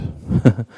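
brief, light laugh; a small laugh after admitting it hurts; short chuckle. Real, not acted. Preacher admitting to the congregation it hurts, short chuckle. Rueful aside about hurting.

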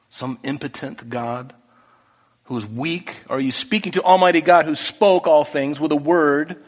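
ordinary delivery

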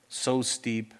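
ordinary delivery

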